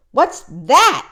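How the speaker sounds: sharp loud scoff; loud snorting scoff; snorted. A narrator's reading. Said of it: "snorted Yertle".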